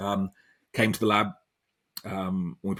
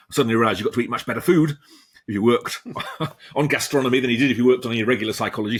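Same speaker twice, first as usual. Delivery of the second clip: louder amused telling, breaking into a laugh; laughing while talking; 3 semitones higher, 6 dB louder, faster than usual. Real, not acted. Guest jokes to the interviewer about eating better food, laughing. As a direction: chuckle through the punchline, fast and loud. Joking about the perks of gastronomy.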